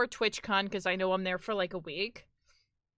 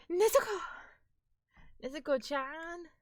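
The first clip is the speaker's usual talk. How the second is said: higher, fast anime shout of Nezuko; anime-style call; 7 semitones higher than usual. Shown as a demonstration. Streamer showing chat how anime says Nezuko, higher fast anime call. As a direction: call out Nezuko like an anime character, rapid and bright. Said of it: "in the anime, it's like".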